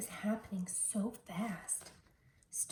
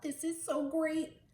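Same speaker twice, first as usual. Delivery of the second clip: Stone's happy thought; 6 semitones higher than usual. Acted. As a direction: think it with childlike joy. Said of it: "he thought".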